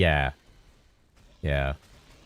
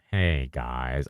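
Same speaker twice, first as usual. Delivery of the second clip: failed Fubgun impression; 7 semitones lower, 4 dB quieter than usual. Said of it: "I can't do it".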